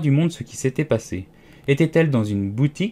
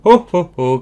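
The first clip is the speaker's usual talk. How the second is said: high, loud "oh" cries in a row; pained wailing ohs; 6 semitones higher, 9 dB louder than usual. A narrator's reading. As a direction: cry out sharply, as if hurt. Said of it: "la reine se mit à hurler si fort".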